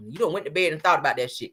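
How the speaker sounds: normal talking voice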